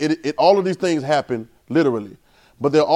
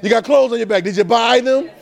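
preaching; 4 semitones higher than usual. Acted. Pastor challenging congregation about buying, fervent. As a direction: drive it at the crowd.

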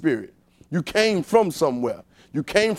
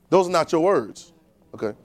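ordinary talk, nothing marked

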